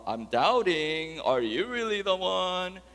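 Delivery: high, drawn-out doubting voice; John the Baptist, doubting. Retold or imitated. Doubter questioning Jesus, imitated drawn-out doubt. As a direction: act out a hesitant, questioning doubter. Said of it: "John the Baptist when he says".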